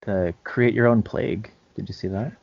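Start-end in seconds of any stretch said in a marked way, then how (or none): none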